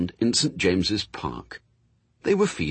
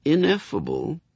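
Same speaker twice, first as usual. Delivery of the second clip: ordinary delivery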